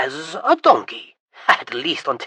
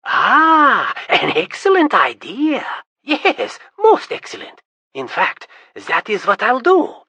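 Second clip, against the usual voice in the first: much higher, louder, gloating glee; gleeful scheming; 8 semitones higher, 8 dB louder than usual. Acted. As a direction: crow over the plan to humiliate Tryon. Prince gleefully plotting his triumph.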